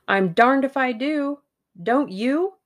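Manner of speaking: high, loud outburst; exasperated. A narrator's reading. Hersheimer banging his fist on the table, exasperated.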